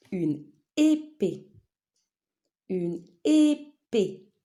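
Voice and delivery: "épée" slowly as É-PÉE; syllable-by-syllable. Shown as a demonstration. Teacher naming a picture for pupils, syllable by syllable. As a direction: set apart both syllables of "épée".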